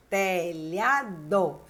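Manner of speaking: telhado drawn out very slowly, tel-lha-do; syllable by syllable. Shown as a demonstration. Teacher showing students three syllables, telhado slowly syllable by syllable. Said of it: "tem três sílabas".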